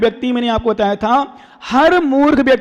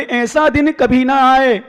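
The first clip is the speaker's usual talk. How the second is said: slow recitation; slower than usual. Retold or imitated. Teaching a phrase to repeat.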